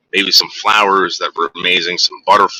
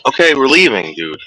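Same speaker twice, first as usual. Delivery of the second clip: higher, sing-song reassurance to a scared dog; soothing the dog; 5 semitones higher than usual. Real, not acted. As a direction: coax the frightened dog gently.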